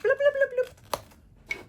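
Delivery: high squeaky tape-rewind bloops; rewind sound effect. Pretend. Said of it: "I'm gonna rewind a little bit".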